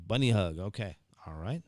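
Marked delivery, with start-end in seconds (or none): 0.0-0.6 punchy repeat of bunny hug; louder repeat. Shown as a demonstration. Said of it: "so a bunny hug".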